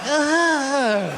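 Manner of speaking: high, drawn-out mock whine; hammed-up miserable child, slow and high; whimpering kid. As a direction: whimper like a scared middle schooler. Frightened kid reacts to threat.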